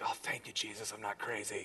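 very soft, low, near-whispered thanks; acting out private relief under the breath; relieved aside. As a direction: murmur it as a hushed thank-you. Privately relieved at raised hands.

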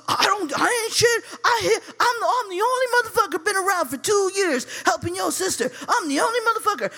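high-pitched, fast self-defence; mock indignant boyfriend. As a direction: imitate him sputtering his defence, high and rushed. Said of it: "he's going in on me".

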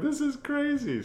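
high-pitched stunned remark; amazed. Retold or imitated. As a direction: say it in wide-eyed disbelief. Seeing the bubbling lobster.

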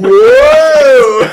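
loud high whoa of surprise; startled yelp. Pretend. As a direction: shout as if something flies at you. 3D scene acted out.

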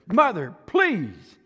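high, loud, drawn-out exasperated plea. Retold or imitated. Daughter in the Anacin ad snapping at her mother, in a high, loud, slow plea. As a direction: stretch it out like a fed-up daughter. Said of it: "she turns around and she says".

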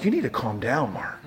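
low, soft restaurant reprimand; hushed telling-off. Retold or imitated. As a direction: quietly tell him to settle. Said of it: "They're like".

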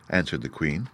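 usual speaking voice

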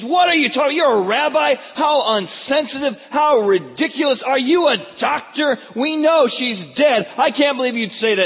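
high, loud indignant shouting; angry ridicule. Acted. Grieving mourners ridiculing Jesus, angry shouted scorn. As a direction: hurl scornful questions at full volume. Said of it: "and they ridicule Jesus".

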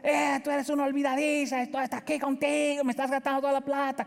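high-pitched mock scolding of a forgetful daughter; father demonstrating the scolding he avoids; mock yelling. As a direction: yell at her the wrong way, on purpose. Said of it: "Puedo gritarle".

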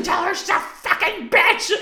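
high, slow, loud retold yell; mom yelling. Mother yelling a message for the window visitor, angry. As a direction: imitate a mother hollering insults. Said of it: "she starts yelling at me".

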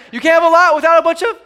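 high, loud, fast cue to the crowd; rallying cue. Real, not acted. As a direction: set up the crowd's answer at a shout. Cues crowd to shout the answer.

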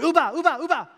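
put-on high, loud insistent little-kid chant; mock toddler demand; imitated toddler babble. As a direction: pester like a toddler with one word.

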